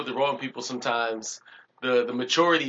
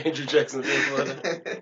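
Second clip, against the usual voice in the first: laughing; 3 semitones higher than usual. Real, not acted. Laughing repeat of joke.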